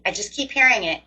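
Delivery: ordinary talk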